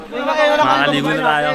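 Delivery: eager, drawn-out call; hyped to jump in; excited. Real, not acted. Finally reaching the river.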